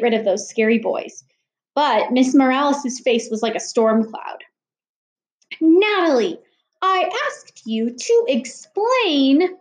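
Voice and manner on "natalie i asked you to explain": slow, stern mom voice, higher; stern mother. Acted. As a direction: demand an answer slowly, like an angry mom. Angry mother questions her daughter.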